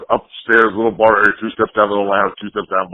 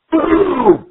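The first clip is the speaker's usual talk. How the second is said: boom. Retold or imitated. Mic crashes down in story.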